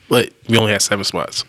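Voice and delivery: usual speaking voice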